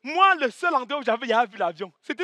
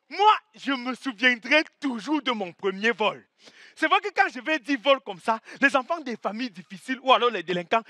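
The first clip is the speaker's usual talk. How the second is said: ordinary delivery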